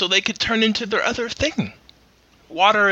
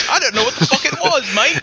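higher, badly faked Australian-style accent; horrible foreign accent; 5 semitones higher than usual. Podcaster excusing the bad impression, horrible fake accent. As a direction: keep up the terrible foreign accent. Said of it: "That was a horrible accent".

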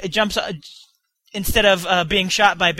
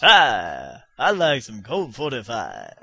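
deep, slow smooth pitchman voice; low and slow, like a smooth ad; Billy D Williams impression; 9 semitones lower, 3 dB quieter, much slower than usual. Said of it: "I do a horrible Billy D Williams impression".

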